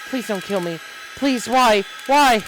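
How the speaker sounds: high, loud, frantic begging; panicked plea; desperate pleading. Acted. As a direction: beg for your life, voice cracking. Begging character breaking down.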